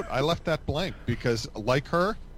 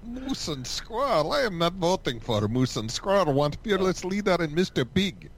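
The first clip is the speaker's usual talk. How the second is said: higher cartoon Russian accent; Boris accent; 4 semitones higher than usual. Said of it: "Boris and Natasha".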